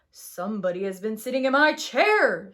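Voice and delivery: loud, gruff big bear; Papa Bear. Acted. Papa Bear finding his chair used, loud gruff. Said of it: "said Papa Bear".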